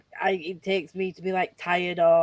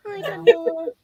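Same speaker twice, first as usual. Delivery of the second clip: nana's voice; 12 semitones higher, 3 dB louder, slower than usual. Retold or imitated. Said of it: "My nana says".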